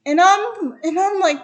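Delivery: ordinary delivery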